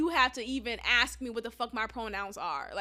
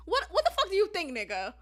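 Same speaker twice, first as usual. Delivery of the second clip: incredulous tone; 5 semitones higher, faster than usual. Retold or imitated. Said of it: "in that kind of tone".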